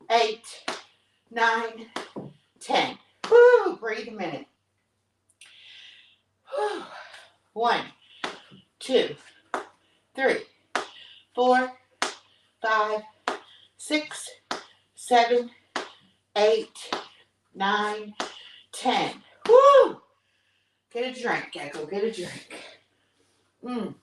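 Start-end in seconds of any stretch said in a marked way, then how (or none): 3.3-3.8 loud, high woo; woo cheer. Real, not acted. Cheering end of set. 19.5-20.0 loud, high woo; woo cheer. Real, not acted. Cheering end of set.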